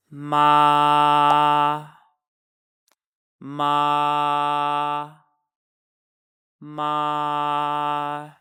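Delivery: each MA held long on one high, unchanging pitch; first, level tone. Shown as a demonstration. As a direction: start ma high and stay there. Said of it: "It is a high-pitched tone".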